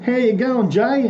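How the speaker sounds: mate greeting someone. Retold or imitated. Old friend greeting Jay out of nowhere, high cheery call. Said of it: "they walk up out of nowhere and they go".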